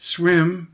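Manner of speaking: Swim, hit hard and loud; stress on swim. Shown as a demonstration. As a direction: punch the word Swim. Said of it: "there's the stress".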